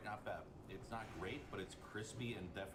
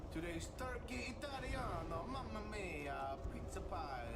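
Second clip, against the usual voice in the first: high, slow Italian chef voice; jolly italian pizza chef; 11 semitones higher, slower than usual. Pretend. Italian sandwich announced in character.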